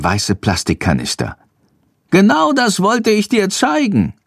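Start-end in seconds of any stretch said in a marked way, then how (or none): 2.1-4.1 high-pitched bat character voice; Schandor. A narrator's reading. Schandor shows Jendrik the canisters, piping high. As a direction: speak up in a piping little creature's voice. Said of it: "sagt Schandor zu Jendrik".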